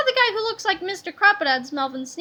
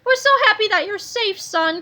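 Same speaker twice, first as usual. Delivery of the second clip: higher, louder joyful relief; father greets son, louder; joyful cry; 5 semitones higher, 4 dB louder than usual. A narrator's reading. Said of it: "cried Mister Sneedley".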